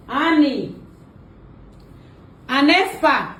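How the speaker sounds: drawn name called to another room; calling out. Pretend. Calling the prank victim to come out, calling out. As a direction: summon someone from afar. So I'll call her.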